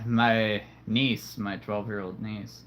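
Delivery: usual speaking voice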